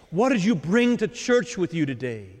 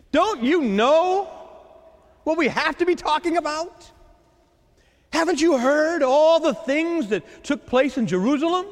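they can't believe he hasn't heard; exasperated disciples; 7 semitones higher than usual. Acted. Disciples complain to Jesus on road.

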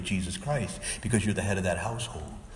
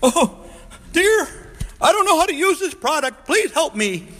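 much higher, louder helpless whine of a clueless man; mock buffoon husband; 8 dB louder than usual. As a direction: ham up the bumbling dad from the ads. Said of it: "he's a buffoon".

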